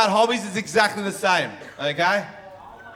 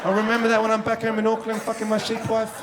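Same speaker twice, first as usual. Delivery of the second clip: put-on Kiwi accent; 2 semitones higher, 4 dB quieter than usual. Comic answers a fan's accent complaint, mock Kiwi accent.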